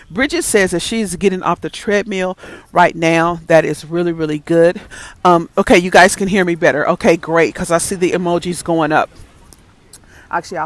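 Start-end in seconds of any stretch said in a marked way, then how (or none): none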